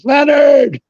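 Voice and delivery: high, loud call across the concourse; yelling a name. Retold or imitated. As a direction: bellow the name down the hall. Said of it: "He starts yelling".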